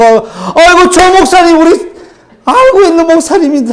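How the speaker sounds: high, wailing "aigo" of a gossiping churchgoer; mock dismayed church lady; shocked onlookers. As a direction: lament like a shocked neighbour.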